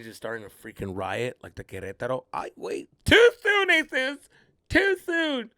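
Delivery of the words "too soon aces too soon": high, louder scolding voice; mock scolding. Comic scolds himself for a dark joke, mock high. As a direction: chide yourself in a higher voice.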